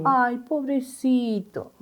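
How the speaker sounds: drawn-out pitying "Ay, pobrecito"; mock pity. Mother mocking parents who excuse their child, slow pitying coo.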